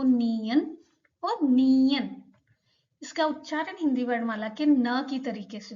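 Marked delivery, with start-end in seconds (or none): 0.0-2.2 नियन said slowly and clearly, twice over; slow model pronunciation. Shown as a demonstration. Teacher repeats नियन slowly for students. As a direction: spell out नियन carefully for the class. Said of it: "समझाने के लिए आपको".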